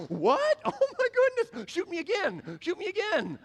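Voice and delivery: high, loud laughing amazement; laughing disbelief. Retold or imitated. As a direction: laugh it off like bullets bounce. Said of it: "he's like".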